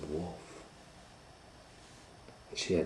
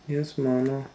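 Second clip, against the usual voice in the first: put-on little girl voice; 8 semitones higher, 5 dB louder than usual. Little girl answers mother.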